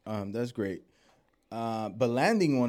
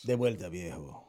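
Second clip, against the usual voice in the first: deep Spanish dub try; 7 semitones lower, 5 dB quieter than usual. Shown as a demonstration. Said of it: "still got a deep voice".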